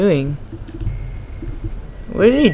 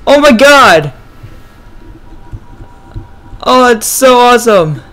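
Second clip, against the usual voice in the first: triumphant cheer; 4 semitones higher, slower than usual. Real, not acted. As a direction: celebrate the win. Enemy defeated.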